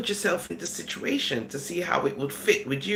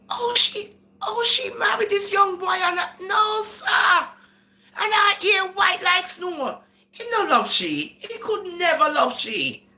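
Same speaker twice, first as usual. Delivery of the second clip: mock the shocked commenters, pitched way up; outraged gossip, voice riding high; scandalised onlookers; 9 semitones higher than usual. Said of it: "This is how you guys would think".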